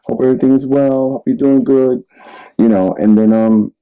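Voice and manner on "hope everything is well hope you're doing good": high, mock-casual check-in; imitating a too-casual message to a crush; feigned nonchalance. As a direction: act breezy and casual, pitched well up. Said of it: "you try to be nonchalant about it".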